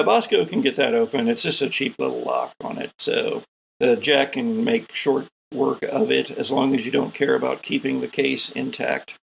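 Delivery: ordinary talk, nothing marked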